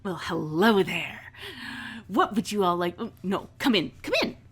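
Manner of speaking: theatrical, intrigued boss; effusive welcome. Acted. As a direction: usher the guests in with a flourish. Vera welcomes the visitors in.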